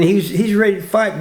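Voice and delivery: ordinary delivery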